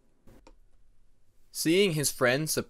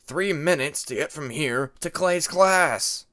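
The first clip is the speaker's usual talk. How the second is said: louder, slow impatient call; annoyed call; 3 semitones higher, 8 dB louder, slower than usual. A narrator's reading. Jaguar calling across the shore to Cliff, annoyed. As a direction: hurry him up, fed up. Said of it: "his voice filled with annoyance".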